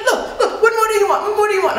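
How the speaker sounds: high-pitched imitation of a livid man; exasperated mock outburst. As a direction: demand it like a coworker losing his temper. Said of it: "he is getting livid".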